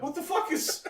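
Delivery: high shocked mother voice; shocked mom. Retold or imitated. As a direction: shriek like a startled mom.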